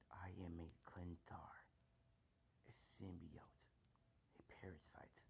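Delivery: dark, hushed symbiote voice; Venom. Acted. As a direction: introduce yourself in a soft dark rasp. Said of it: "just think of a Venom voice".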